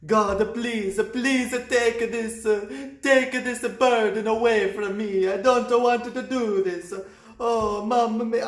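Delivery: over-the-top praying priest, mamma mia; pleading Italian priest. Retold or imitated. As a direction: beg God in a hammed-up Italian priest voice. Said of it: "he was like".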